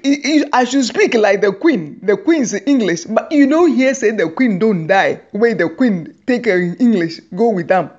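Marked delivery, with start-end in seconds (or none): none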